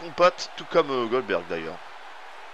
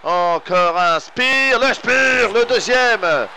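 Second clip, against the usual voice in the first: much higher, louder, slow stretched yell; excited shout; 6 semitones higher, 4 dB louder, much slower than usual. Real, not acted. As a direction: scream the second spear. Second spear, climax. (cri très aigu et fort)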